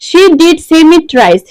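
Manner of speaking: did punched, whole line spoken very slowly; stress on did. Shown as a demonstration. Teacher showing students how did adds emphasis, slow and stressed. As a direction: insist on did. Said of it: "did শব্দটি কিন্তু এই thrice বা তিনবার শব্দটিকে জোর দিয়ে বলছে".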